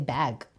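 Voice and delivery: "Bag" with the flat American æ, not u. Shown as a demonstration. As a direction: say "Bag" as in cat. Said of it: "you have to say". ("Bag" na Amerikano ang a)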